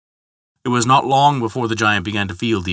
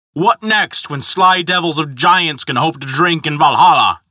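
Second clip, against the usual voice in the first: high, indignant outburst; angry; 5 semitones higher, 4 dB louder than usual. A narrator's reading. Thor railing at giants drinking in Valhalla, angry. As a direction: rail at them in outrage. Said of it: "No one had seen him more angry".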